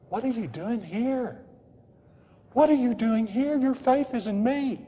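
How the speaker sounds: soft, high, pleading voice; hushed, tender appeal; God gently calling believers. Acted. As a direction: plead quietly with someone who has strayed. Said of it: "I'm sure he said to many believers".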